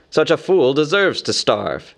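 firm, disdainful character voice; scornful Bard. A narrator's reading. Bard on Thorin starving, scornful. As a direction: pass judgment on a fool.

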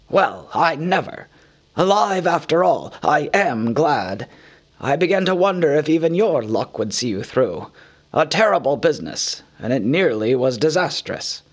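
put-on old wizard, gladly relieved then sober; Gandalf's voice. Relieved Gandalf greets the surviving hobbit, warm then sober.

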